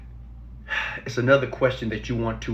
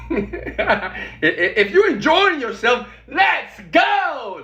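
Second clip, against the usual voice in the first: laughing hype shout; 11 semitones higher, 3 dB louder, much slower than usual. Real, not acted. Laughing hype call to viewers.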